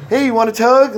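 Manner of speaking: high, loud, friendly offer of the bottle; cheery offer. Retold or imitated. Host offering guests a swig from the bottle, high and loud. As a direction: push the fifth on them gladly. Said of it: "Like, like".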